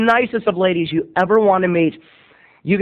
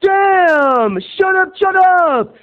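much higher, louder mock yell; class yelling; 11 semitones higher, 4 dB louder, much slower than usual. Classmates shouting at Terry to shut up, loud and high. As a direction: shout him down like annoyed classmates. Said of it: "everyone's like".

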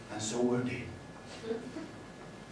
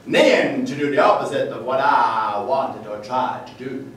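greasy Texan poem; 12 semitones higher, 13 dB louder than usual. Acted. Poet reciting his horse-ride poem to the audience, loud Texan accent. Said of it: "to be read in a greasy Texan accent".

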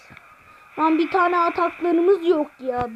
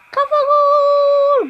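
excited goal call; 8 semitones higher, 5 dB louder, slower than usual. Real, not acted. Scoring a header.

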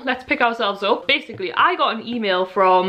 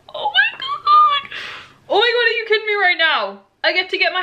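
very high laughing squeal, easing into high disbelief; ecstatic squeal; 11 semitones higher, 3 dB louder than usual. Real, not acted. Fan reading her Disney invitation, squealing ecstatically.

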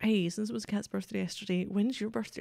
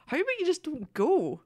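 exasperated; 9 semitones higher, 4 dB louder than usual. Real, not acted. Exasperated reply to travel advice.

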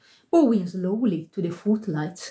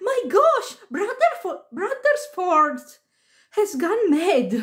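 high-pitched astonished outburst; excited cry; 9 semitones higher than usual. A narrator's reading. Second boy reacts to the spectacle, high astonished cry. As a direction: exclaim high, like an amazed boy. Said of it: "cried the second boy".

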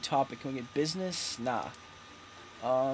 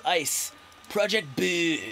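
slow title with "Boom" drawn out long; signature title inflection; slower than usual. Shown as a demonstration. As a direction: stretch "Boom" into a long falling glide. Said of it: "say it with that inflection".

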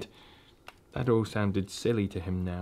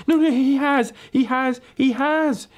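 very high, loud little squeal; squeaking; 5 dB louder than usual. Acted. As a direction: squeak it out in panic. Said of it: "it squeaked".

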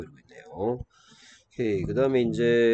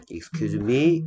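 "Excuse me" higher, rising on "me"; rising question; 4 semitones higher, 6 dB louder, much slower than usual. Shown as a demonstration. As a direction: ask "Excuse me" as a request to repeat. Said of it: "이렇게 읽죠".

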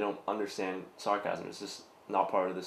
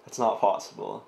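laughing; 8 dB louder than usual. Real, not acted.

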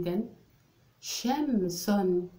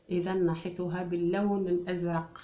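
normal talking voice

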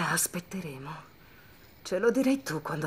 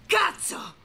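panicked yell; 13 semitones higher than usual. Acted. Sudden panic after struggle.

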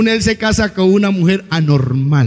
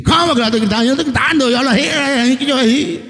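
pitched-up indignant loud talker; mock indignant spouse, pitched up; loud Santander spouse; 8 semitones higher, faster than usual. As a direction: protest that this is just how you talk, voice high. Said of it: "una familia que uno habla duro".

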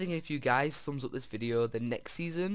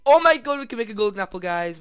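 much louder, higher burst of joy; excited shout; 8 semitones higher, 14 dB louder, faster than usual. Real, not acted. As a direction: shout the discovery to the viewers. Finds gold for golden apple.

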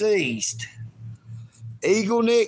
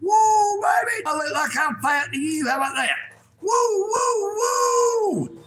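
excited whooping; 11 semitones higher than usual. Real, not acted. Vlogger shows off his body to viewers, whooping high. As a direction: crow about yourself with big whoops.

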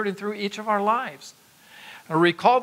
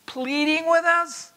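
emphatic pleading; 9 semitones higher, slower than usual. Acted. Preacher repeats pleading emphatically.

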